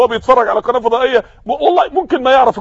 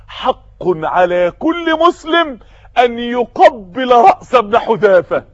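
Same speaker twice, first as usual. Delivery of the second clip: slow solemn proclamation; much slower than usual. Acted. Umar quoted with honor.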